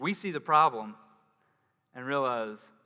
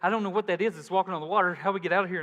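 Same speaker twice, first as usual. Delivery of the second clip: mock panic, a bit louder and higher; panicked disciples; 4 semitones higher, 6 dB louder, faster than usual. Disciples in the boat seeing Jesus on the water, mock panic. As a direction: play the scared disciples spotting a ghost. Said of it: "They're going".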